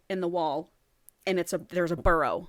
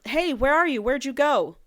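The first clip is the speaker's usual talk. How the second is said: higher, louder call into the dark; 6 semitones higher, 4 dB louder than usual. Acted. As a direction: call down looking for her. Calling out down the pit.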